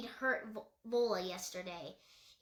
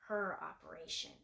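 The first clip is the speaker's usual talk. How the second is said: barely voiced, much softer, only lips moving; mouthed; 5 semitones lower, 7 dB quieter than usual. A narrator's reading. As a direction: shape the words almost silently. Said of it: "he mouthed".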